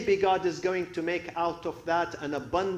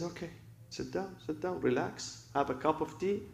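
calming reassurance; 9 dB quieter than usual. Retold or imitated. Scholars calm him with tea.

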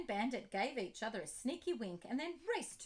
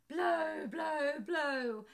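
blowing noises; 4 semitones higher, 7 dB louder, much slower than usual. Acted. Blowing sound effect.

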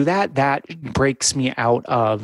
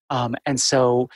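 ordinary delivery

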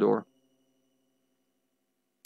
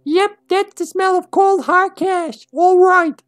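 slow, very high falsetto; squeaky kid voice; slower than usual. Shown as a demonstration. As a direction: read it slowly in a squeaky child's voice. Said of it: "that doesn't work for Kid".

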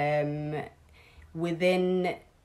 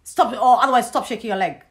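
retold scolding; 5 semitones higher, 8 dB louder than usual. Mother-in-law voiced ordering her out.

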